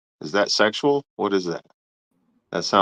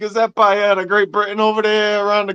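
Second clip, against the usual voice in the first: teasing Italian-accent imitation, higher pitch; mock Italian accent; 9 semitones higher than usual. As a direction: mimic your co-host with a put-on Italian lilt. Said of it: "You just sounded Italian".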